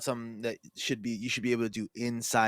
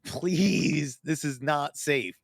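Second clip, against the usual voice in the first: drawn-out, higher-pitched begging; mock pleading; 3 semitones higher, much slower than usual. Co-host re-enacts begging Hunter over a frozen house, mock pleading. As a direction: plead slowly, hammed-up and stretched.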